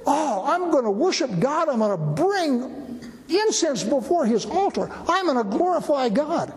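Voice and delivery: high, hammy pious priests; mock pious eagerness. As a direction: lay on the smug piety. Priests' eager words mocked.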